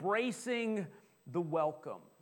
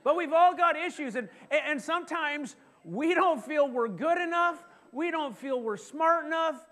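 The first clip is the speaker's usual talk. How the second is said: rising preaching; 7 semitones higher, 4 dB louder than usual. Real, not acted. Preaching climax about feeling inadequate.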